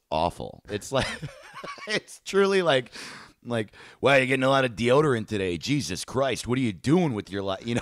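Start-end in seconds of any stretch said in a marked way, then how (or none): 4.0-7.6 higher-pitched, needling mock heckle; mock aggressive trash talk. Grocery clerk talks shit to a customer, mock aggressive. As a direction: talk trash like a pushy cashier, playfully. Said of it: "tonally so aggressive".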